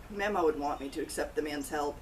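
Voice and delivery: ordinary delivery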